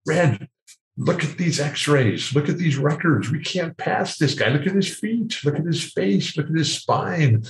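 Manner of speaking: a hint of a fretting doctor pleading; worried doctor. Doctor urging Red over the x-rays, mildly anxious. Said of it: "I can hear him in the corners telling Red".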